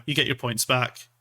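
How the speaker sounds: normal talking voice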